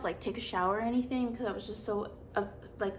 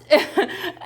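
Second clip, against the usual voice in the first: a sudden high laugh bursting out; loud high laughing burst; burst of laughter; 6 semitones higher, 11 dB louder than usual. Real, not acted. Laughing at teacher's reaction.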